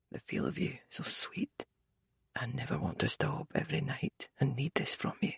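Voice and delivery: hushed breathy whisper; whispered murmur. A narrator's reading. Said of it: "his quiet murmurs".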